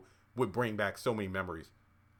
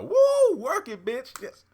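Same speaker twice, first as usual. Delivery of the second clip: very high, loud woo then cheer; hyped cheer; 10 dB louder, slower than usual. Pretend. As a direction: hype her up like a fan. Cheering Madison's strut.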